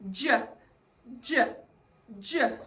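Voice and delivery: "j" as a short "juh", not the letter name; three punched, separate "j" sounds; letter-sound demo. Shown as a demonstration. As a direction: say "j" as in jellyfish, cut short. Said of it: "and he says".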